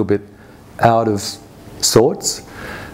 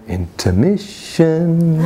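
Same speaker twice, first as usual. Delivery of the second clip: eerie voice; 5 semitones higher, much slower than usual. Retold or imitated. Eerie voice heard on waking.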